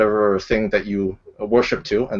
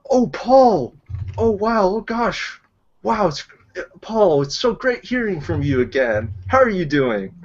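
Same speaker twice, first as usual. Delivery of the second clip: mock overjoyed; 10 semitones higher, slower than usual. Overjoyed fake greeting to scammer.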